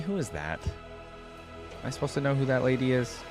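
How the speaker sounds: ordinary talk, nothing marked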